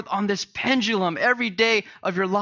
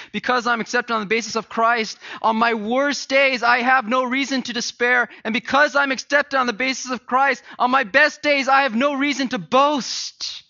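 higher, louder preaching building in intensity; full-force preaching; 7 semitones higher, 5 dB louder than usual. Real, not acted. Pastor proclaims acceptance in Christ to the church, full force. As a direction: rise into a ringing proclamation.

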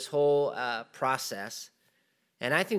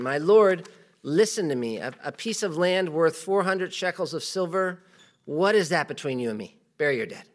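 raised, louder Bible reading voice; scripture reading; 4 semitones higher, 4 dB louder than usual. Acted. Preacher reads scripture aloud to church, louder. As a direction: read the verse out to the congregation.

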